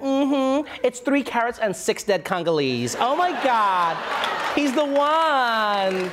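gushing socialite, drawing out the end; mock-swooning rich lady. Rich lady bragging about her unethical diamond, mock swoon. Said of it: "some rich lady who's like".

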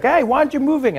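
shouting policeman. Retold or imitated. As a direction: demand an answer, shouting. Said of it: "he's like".

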